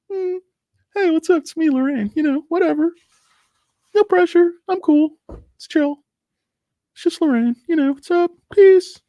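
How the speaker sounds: very high-pitched falsetto take on a chill regular; laid-back Lorraine. Acted. Streamer imagining a chill viewer introducing herself, laid-back falsetto. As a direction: squeak it in airy, casual falsetto. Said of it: "She's kinda like".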